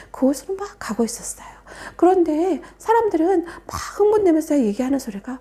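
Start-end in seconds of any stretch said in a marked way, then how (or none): none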